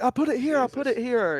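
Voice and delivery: high pleading repetition; a hint of a scared rookie; panicked trainee. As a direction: insist desperately.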